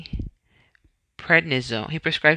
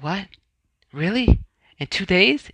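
higher, mildly astonished questions; retold disbelief; 6 semitones higher than usual. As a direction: react as if hearing surprising news. Retold disbelief at quick appointment.

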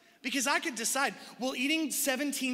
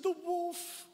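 put-on little girl voice; 7 semitones higher, 4 dB quieter than usual. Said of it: "She said".

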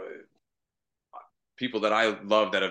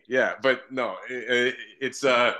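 normal talking voice

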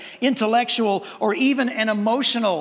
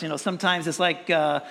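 rushed mumbled recitation; 4 semitones lower, faster than usual. Retold or imitated. Preacher mocking kids who race through memory verses, fast mumble. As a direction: gabble the verse low and fast, like a bored kid. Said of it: "you gotta do it as fast as possible".

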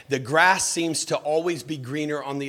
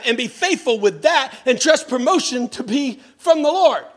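loud, high, driving sermon peak; full-force preaching; 9 semitones higher, 6 dB louder than usual. Acted. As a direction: push the point home to the congregation.

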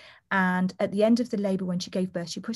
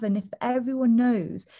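usual speaking voice

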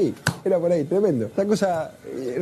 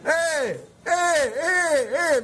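imitated distant call; 10 semitones higher, much slower than usual. Diego hailing him on the beach, imitated high call. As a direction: mimic someone hailing from afar. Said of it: "me grita el Diego así".

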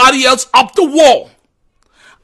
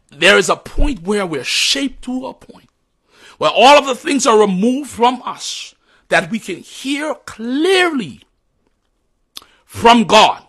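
ordinary delivery

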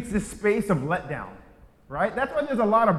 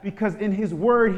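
ordinary delivery

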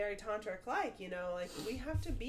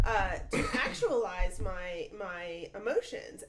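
ordinary talk

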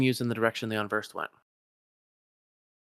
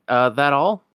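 ordinary talk, nothing marked

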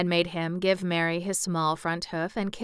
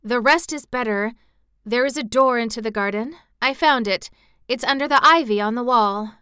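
raised pitch, loud excited reveal; solemn excitement; 5 semitones higher, 6 dB louder than usual. A narrator's reading. Mary tells Colin of the garden door, solemn excitement. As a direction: break big news with earnest excitement. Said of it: "almost pale with solemn excitement".

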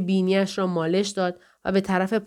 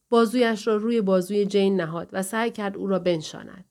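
plain reading voice

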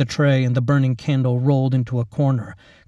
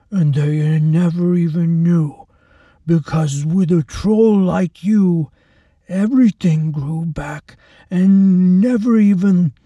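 higher, louder thuggish gloat; brutal orderly, gloating; 5 semitones higher, 5 dB louder than usual. Acted. Orderly taunting the helpless girl before the storm, gloating. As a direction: sneer it out like a bully enjoying himself.

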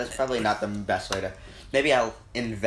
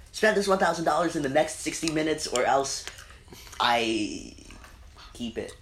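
MrBeast voice; 7 semitones higher than usual. Retold or imitated. Mimics MrBeast challenge.

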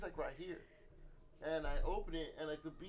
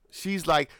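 normal talking voice

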